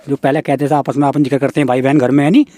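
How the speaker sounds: ordinary talk, nothing marked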